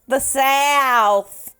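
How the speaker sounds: high, loud mock Southern accent; mock Southern drawl. Joking about how the South talks, mock Southern holler. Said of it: "as they say in the South".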